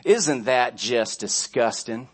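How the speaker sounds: slow, drawn-out Southern disapproval; Southern accent. Retold or imitated. Preacher mimicking his offended friend, slow Southern accent. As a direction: imitate a disgusted Southerner, stretching the words. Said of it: "I had to put the southern accent on it".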